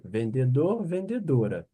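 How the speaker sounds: usual speaking voice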